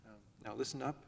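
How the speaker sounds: soft request. Retold or imitated. Father at the door asking kids to listen, hushed. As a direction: ask them softly to listen. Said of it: "that isn't always as effective as raising your voice".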